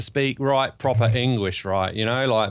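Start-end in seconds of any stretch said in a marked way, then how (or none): none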